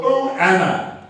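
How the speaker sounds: slow, hand-on-heart "Oh, Anna"; heartfelt sigh. Shown as a demonstration. Evaluator to the audience, demonstrating the line, slow and heartfelt. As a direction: breathe it out slowly, full of feeling. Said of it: "put your hand in your heart and go".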